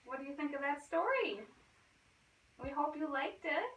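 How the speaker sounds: plain reading voice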